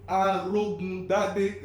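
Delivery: "Arogun Dade" spelled out slowly, syllable by syllable; slow, careful name. Shown as a demonstration. Guest slowly teaching the host his name, "Arogun Dade". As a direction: drag out "Arogun Dade" so it sinks in. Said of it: "you mispronounced my name".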